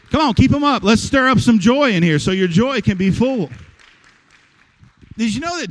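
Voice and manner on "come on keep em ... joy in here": higher and louder, pushing the crowd on; louder rallying call. Real, not acted. Pastor to congregation, urging hands up in a loud, high rallying call. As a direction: really lift the room with it.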